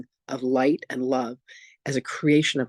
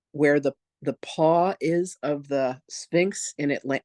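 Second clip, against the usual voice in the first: usual speaking voice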